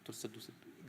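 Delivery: soft whispered aside; hushed whisper. Real, not acted. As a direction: drop to a whisper as she sobs. Telling how his wife sobbed.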